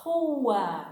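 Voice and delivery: Rua with a throaty h-like R; Portuguese R. Shown as a demonstration. Teacher modelling Rua for students to repeat. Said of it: "Repeat after me".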